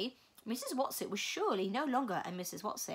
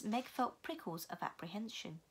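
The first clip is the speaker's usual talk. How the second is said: ordinary delivery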